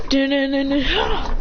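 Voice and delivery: slow, rising "dun-dun" effect; sung-out suspense sting. Retold or imitated. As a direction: draw out a mock dramatic sting.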